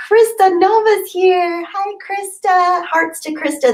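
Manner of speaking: high, slow excited hello; high, drawn-out happy welcome; sing-song greeting. Real, not acted. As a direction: call her name out like you're thrilled. Greeting a viewer with delight.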